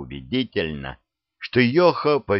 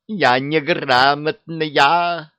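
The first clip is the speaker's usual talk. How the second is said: high, slow, loud wail; 7 semitones higher, 4 dB louder, much slower than usual. Acted. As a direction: howl it in despair. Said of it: "взвыла Яга". (взвыла)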